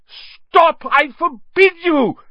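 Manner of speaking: very high, loud shout; frantic, full-voiced ban; panicked yell. A narrator's reading. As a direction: yell desperately to silence him. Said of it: "yelled Uncle Vernon in panic".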